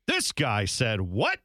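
slow, drawn-out disbelief; mock incredulity. Host voicing Americans reacting to the coach, mock incredulity. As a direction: stretch it out like a baffled fan. Imitating baffled American fans.

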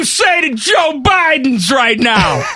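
high-pitched, drawn-out hammed-up shout; mock shouted question. Host imitating a viral street interview, mock shouting.

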